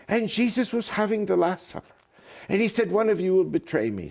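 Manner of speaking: high-pitched Irish priest; Irish accent. Acted. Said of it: "he says with his Irish accent".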